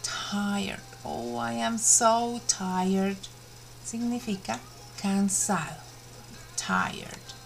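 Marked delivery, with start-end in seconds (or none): 1.1-3.3 slow, mock weary sigh; tired. Shown as a demonstration. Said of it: "we have tired". 6.6-7.3 "Tired" whispered low and worn out. Shown as a demonstration. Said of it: "we have tired".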